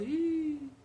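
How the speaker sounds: high, mildly frustrated Ih, sei não; a touch of annoyance; mock frustrated. Shown as a demonstration. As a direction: play a fed-up shrug. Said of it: "quando você tá meio frustrado".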